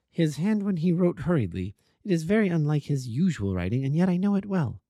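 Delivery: wife welcoming the detective, composed but anxious. A narrator's reading. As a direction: play the brave hostess. Narrator voices Mrs St Clair.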